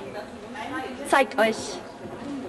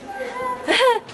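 laugh; 10 semitones higher than usual. Real, not acted. Laughing at bunny in work clothes.

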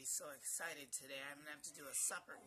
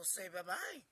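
usual speaking voice

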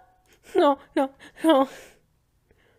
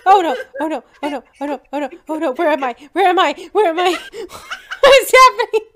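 panicked yelling; 4 semitones higher, 7 dB louder, much faster than usual. Real, not acted. Panicking at game.

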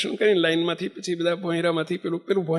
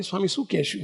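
softer, quiet private question; soft aside; 7 dB quieter than usual. Retold or imitated. Bapji repeats his question to the swami, quietly. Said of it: "બાપજી મને ધીમે રહીને કે".

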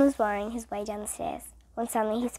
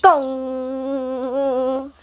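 gong; 6 dB louder than usual. A narrator's reading. Gong sound imitated.

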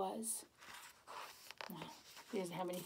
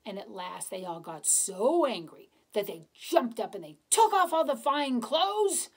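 ordinary delivery, nothing marked

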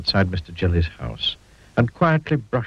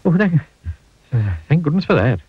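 relieved sigh; 7 semitones higher, 3 dB louder than usual. A narrator's reading. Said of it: "he said".

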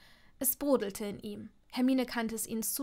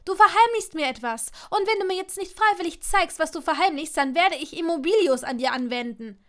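loud, raised, insistent voice; angry threat; 6 semitones higher, 9 dB louder than usual. A narrator's reading. Hermine threatens a spell over his secret, loud and angry. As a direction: press him hard with an ultimatum.

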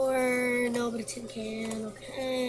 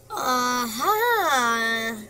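much louder, a shout of discovery; triumphant aha; 10 dB louder than usual. Real, not acted. Player finding the hidden object, loud triumphant cry. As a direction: crow it as you find it.

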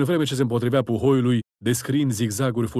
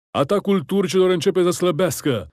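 high, raised rallying cry; encouraging shout; 7 semitones higher, 4 dB louder than usual. A narrator's reading. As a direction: hearten your soldiers mid-battle. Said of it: "strigă Bazileul, încurajându-și oamenii". (strigăt de încurajare)

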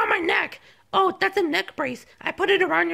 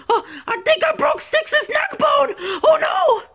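high, alarmed cartoon shout; horrified high-pitched outburst; panicked cry; 8 semitones higher than usual. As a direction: blurt it out in a panic. Panics over the broken neck bone.

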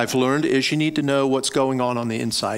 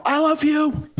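yelling out; 14 semitones higher, slower than usual. Pretend. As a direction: call it up to the bleachers, much higher. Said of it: "they don't need me yelling out".

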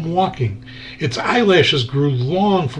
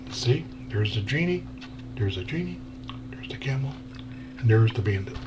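whispering, much softer; hushed aside; 6 semitones lower, 12 dB quieter, faster than usual. A narrator's reading. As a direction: point them out in a hush. Aside pointing at the picture.

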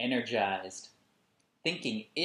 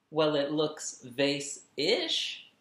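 slow, louder, stretched exclamation; exclaimed; 4 semitones higher, 5 dB louder, much slower than usual. Acted. Sister cheering her brother's drawing, a slow exclamation. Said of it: "she exclaimed".

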